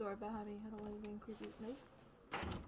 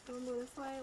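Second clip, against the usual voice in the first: ordinary talk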